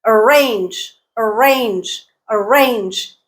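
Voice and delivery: arrange with RANGE long and strong; second-syllable stress. Shown as a demonstration. Teacher showing learners word stress, arrange stressed on RANGE. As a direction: keep the first syllable of arrange weak, lean on RANGE. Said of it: "Range is the stressed syllable".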